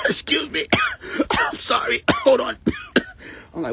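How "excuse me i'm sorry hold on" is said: imitating coughing fit. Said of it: "She started saying".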